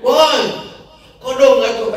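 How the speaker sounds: very high, loud mock holler; imitated yell. Woman yelling back after being honked at, imitated shout. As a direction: mimic an indignant yell from a car window. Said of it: "Dia teriak".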